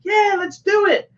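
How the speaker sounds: high, slow imitation of an eager wife; mock eager insistence.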